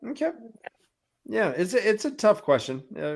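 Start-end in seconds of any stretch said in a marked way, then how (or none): 0.1-0.3 sing-song patronising okay; high mock-approving okay; condescending. Real, not acted. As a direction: pat them on the head with it. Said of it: "So condescending".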